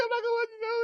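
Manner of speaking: high, teary voice, half sobbing; choked-up quote. Real, not acted. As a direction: say it as tears come. Repeating the line, overcome.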